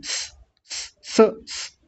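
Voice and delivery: soft unvoiced hiss through teeth. Shown as a demonstration. Teacher demonstrates the half स hiss. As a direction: hiss like shooing a cat. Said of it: "आप पहले इसी को बोलिए".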